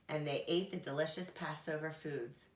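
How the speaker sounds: plain reading voice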